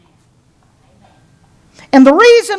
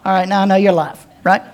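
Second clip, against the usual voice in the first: normal talking voice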